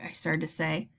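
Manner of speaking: ordinary delivery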